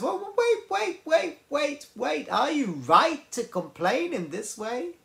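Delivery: high-pitched wait wait, then settling; gently cutting off a whiner, starting very high; God interrupting. Retold or imitated. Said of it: "and God says".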